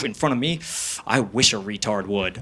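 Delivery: low, soft mock threat; understated menacing dare; retold quiet anger. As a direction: imitate an angry friend, kept low and quiet. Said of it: "He was angry".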